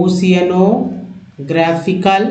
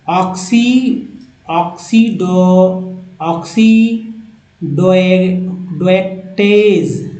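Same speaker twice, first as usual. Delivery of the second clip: syllable by syllable; slower than usual. Shown as a demonstration. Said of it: "oxido- (split into parts)".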